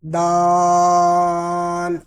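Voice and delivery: دال stretched very long and slow; long daal. Shown as a demonstration. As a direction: hold the vowel of دال. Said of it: "اس کو لمبا کریں گے".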